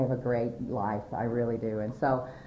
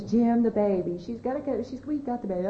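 high-pitched retold plea; pleading; 8 semitones higher than usual. Woman pleading with dad for jailed daughter, high imploring voice. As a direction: beg him on the daughter's behalf. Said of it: "she was just pleading".

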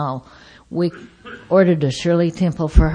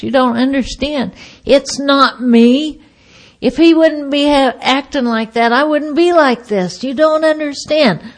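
high-pitched acted-out excuse-making; imitating a worked-up, self-justifying wail; pleading protest; 6 semitones higher than usual. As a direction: plead your innocence, pitch climbing. Acting out her blaming, pleading excuses.

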